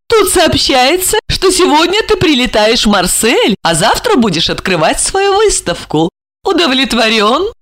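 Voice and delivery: намного выше, медленно; Ольга, нежно-игриво; голос Ольги. Acted. (Ольга встречает Жарона)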